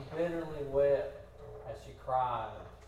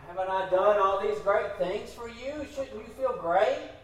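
higher, louder imitation of a puzzled husband; pleading husband; 7 semitones higher, 7 dB louder than usual. Husband asks his grieving wife why she isn't happy, raised and insistent. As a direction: press the questions upward, wanting to fix it. Acts out husband trying to fix it.

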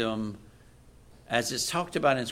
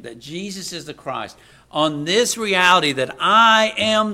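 preaching at full force; 2 semitones higher, 6 dB louder than usual. Acted. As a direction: hammer home the promise. Preaching Jesus' words to Peter.

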